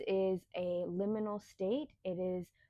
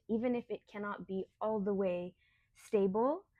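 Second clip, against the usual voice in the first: normal talking voice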